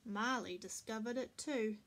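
ordinary delivery, nothing marked

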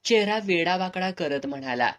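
plain reading voice

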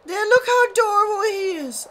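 very high, loud gush of delight; adoring squeal. Real, not acted. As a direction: squeal over something unbearably cute. Gushing at a cute creature.

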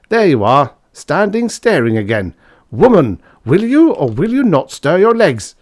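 high, loud, building outburst; furious bullying husband; shriek of rage. A narrator's reading. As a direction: build to an enraged shriek at the end. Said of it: "delivered with a perfect shriek of rage".

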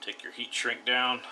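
normal talking voice

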